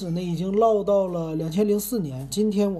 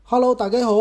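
Cantonese greeting, higher and louder; 5 semitones higher, 6 dB louder, faster than usual. Shown as a demonstration. Host greeting listeners in a new opening, Cantonese. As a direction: launch the show opening in Cantonese, pitched up. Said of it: "粤语里边儿怎么说".

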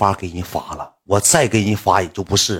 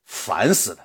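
imitating an annoyed woman; 2 semitones lower, 4 dB quieter, slower than usual. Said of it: "我给你模仿一下女性当时发那个微信".

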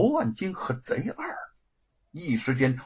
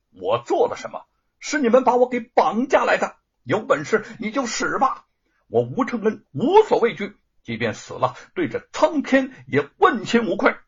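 higher, louder defiant protest; 7 semitones higher, 5 dB louder than usual. Acted. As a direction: fling it back at your captor, unafraid. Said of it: "大声地说". (吴承恩被绑来，大声顶撞罗万金)

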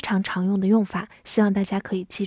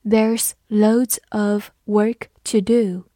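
slow, careful model reading; teacher reading slowly for the class; slower than usual. Shown as a demonstration. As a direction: read it slowly word by word. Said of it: "我们慢读一遍".

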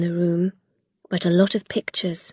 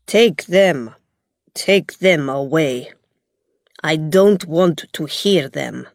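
stern Gog; 4 dB louder than usual. A narrator's reading. Gog refusing and ordering guards.

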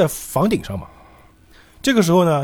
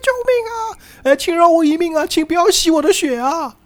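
screaming for help. Acted. Frightened woman begs not to be bitten, screaming. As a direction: play a terrified woman wailing for mercy. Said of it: "哎，就在那喊".

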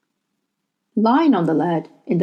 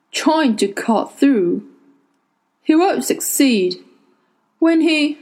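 put-on old man voice; 6 semitones higher, slower than usual. A narrator's reading.

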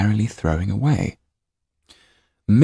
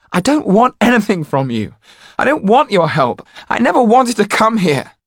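much higher, louder tearful shout; 7 dB louder than usual. A narrator's reading. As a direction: yell at them through tears. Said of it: "Jan was shouting now".

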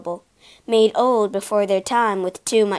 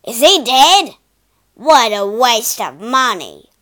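much louder, scornful complaint; a cruel owner fuming over money; loud, slowed disgust; angry master; 9 dB louder than usual. A narrator's reading. Cruel master at fallen horse.

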